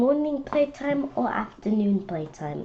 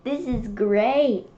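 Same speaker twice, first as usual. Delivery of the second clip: usual speaking voice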